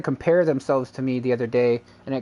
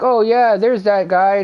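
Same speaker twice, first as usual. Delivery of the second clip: loud, raised-pitch imitation; mimicked announcement; 7 semitones higher, 8 dB louder, slower than usual. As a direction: mimic a streamer calling someone out, louder and higher. Mimics streamer noticing him in chat.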